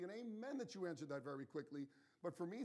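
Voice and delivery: normal talking voice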